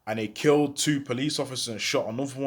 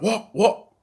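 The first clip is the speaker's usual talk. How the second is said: a short mock-angry flare, louder and higher; hyping up; 6 semitones higher, 4 dB louder than usual. To the rival at the window, hyping up. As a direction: snap it back, getting worked up. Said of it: "Hyping up".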